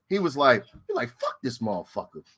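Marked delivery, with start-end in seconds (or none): 1.2-2.2 low, much softer mutter; deep quiet mock grumble; mimicking Fred. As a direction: grumble it under the breath. Fred voiced in retold story.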